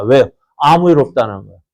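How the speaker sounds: ordinary talk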